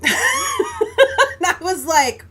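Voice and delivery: laughing through the words, stretched slowly; can barely get it out for laughing. Real, not acted. Telling how she answered the clerk, laughing hard.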